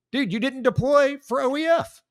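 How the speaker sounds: high-pitched, indignant disbelief; incredulous rebuke. Real, not acted. Veteran calling out a politician's deployment claim, incredulous.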